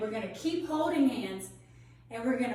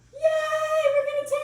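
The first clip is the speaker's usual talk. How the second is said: high, loud sing-song yay; bright sung announcement; excited cheer; 6 dB louder than usual. Real, not acted. Excited teacher to kids.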